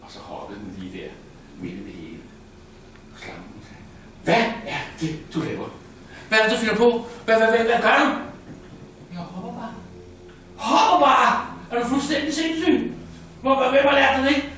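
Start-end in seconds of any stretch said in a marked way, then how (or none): 4.2-14.4 high, loud outraged mother; scolding mother. Acted. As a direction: scold the little one in disbelief. Snake mother scolds her child. (slangemoren skælder ud, høj og vred)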